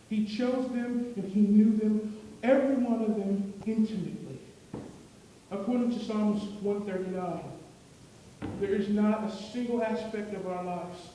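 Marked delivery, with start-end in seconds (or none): 0.1-9.2 full-force preaching. Acted. Preacher proclaims to the congregation, full force. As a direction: drive the point home from the pulpit.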